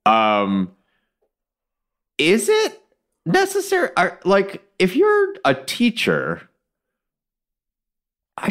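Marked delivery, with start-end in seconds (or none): 2.2-6.5 incredulous. Real, not acted. Incredulous question to hosts.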